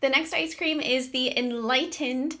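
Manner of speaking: ordinary talk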